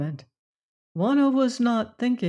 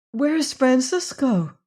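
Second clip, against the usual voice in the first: frightened; 7 semitones higher, 4 dB louder, slower than usual. Acted. Frightened woman asks about lover.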